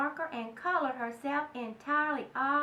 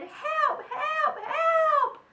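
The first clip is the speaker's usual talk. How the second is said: high, loud frantic calls for help; mock panic, much higher and louder; panicked cry; 13 semitones higher, 7 dB louder, much slower than usual. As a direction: shriek down the phone to the doctor. Mother phoning doctor in panic.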